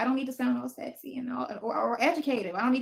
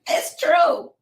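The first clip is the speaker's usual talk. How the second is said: high, loud burst of insistence; emphatic insistence; 10 dB louder than usual. Real, not acted. Insisting to viewers after a laugh, emphatic cry.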